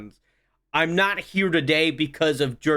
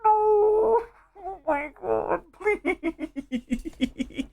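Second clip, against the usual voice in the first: mock pleading; much slower than usual. High mock pleading after joke.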